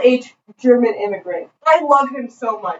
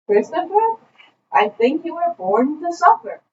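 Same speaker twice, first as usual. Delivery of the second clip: higher-pitched, deadpan German professor; Armin's thick German accent; 5 semitones higher than usual. Retold or imitated. German professor sizes up injured student, thick accent. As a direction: pronounce it in a heavy German accent. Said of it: "saying this was the thickest German accent".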